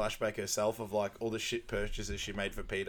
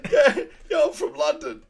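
hammed-up movie quote; 12 dB louder than usual. Quoting film character, exaggerated.

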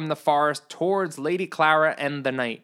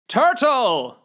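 loud call of the name "Turtle!"; shout; 7 semitones higher, 6 dB louder than usual. Acted. Knight calling his horse Turtle, loud shout. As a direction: yell for the horse across the field. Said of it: "he shouted".

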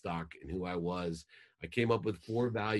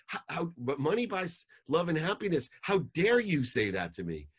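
higher, louder indignant disbelief; offended outburst; 6 semitones higher, 3 dB louder than usual. Retold or imitated. Husband to wife announcing she is leaving, indignant raised outburst. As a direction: retell your outraged protest, voice climbing. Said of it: "And I was so offended".

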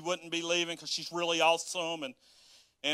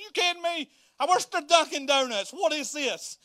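mock outrage; 10 semitones higher, 3 dB louder than usual. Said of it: "I was upset about it".

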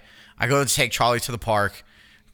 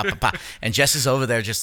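ordinary talk

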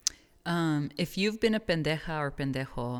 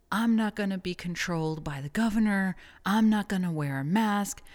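ranting Karen; 4 semitones higher, 3 dB louder than usual. Retold or imitated. Quoting a ranting maskless Karen.

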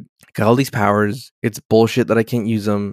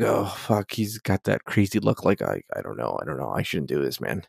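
much lower, much softer worried mumble; low nervous mutter; 5 semitones lower, 9 dB quieter than usual. Retold or imitated. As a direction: mumble it under your breath, spooked. Riker nervous, acted quote.

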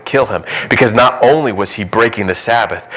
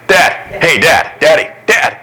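higher, eager repeated calling of Dad; kids calling dad; 6 semitones higher than usual. Retold or imitated. Father imitating his boys calling for him, higher and eager. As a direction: call out Dad over and over like a kid. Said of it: "in our house it's like".

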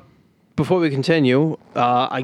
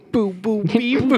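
Pablo Francisco bit; 6 semitones higher, slower than usual. Retold or imitated. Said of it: "Shout out to Pablo Francisco".

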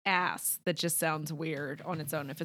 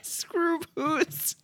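higher-pitched cartoon Canadian vowels; mock Canadian accent; 8 semitones higher than usual. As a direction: echo a silly Canadian "oo", pitched up. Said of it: "What would Canadians say?".

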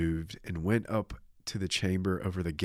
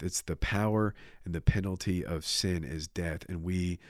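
ordinary delivery

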